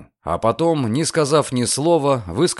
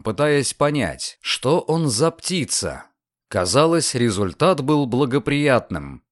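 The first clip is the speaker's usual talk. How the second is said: ordinary delivery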